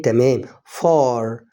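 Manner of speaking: far drawn out, open a then a heavy r; slow word demo. Shown as a demonstration. Teacher building the word far for learners, slowly. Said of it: "تبقى الكلمة إيه؟".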